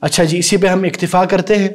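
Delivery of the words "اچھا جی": usual speaking voice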